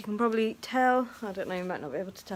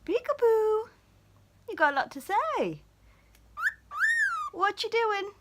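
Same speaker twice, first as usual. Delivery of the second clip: higher cooing singsong; 12 semitones higher than usual. Real, not acted. Owner playing peekaboo with her parrot, high baby talk. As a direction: play peekaboo with the bird.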